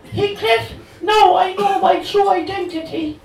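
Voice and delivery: Cathy, high and loud in bad Cork brogue; dodgy Cork accent. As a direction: gush at him in a hammed Cork lilt. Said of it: "delivered in a dodgy Cork accent".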